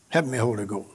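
normal talking voice

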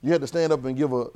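loud, high, rousing preaching; energetic sermon push; preaching at full force. Acted. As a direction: call out to the congregation, fired up. Preacher presses congregation on speech.

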